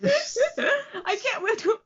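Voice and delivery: ordinary talk, nothing marked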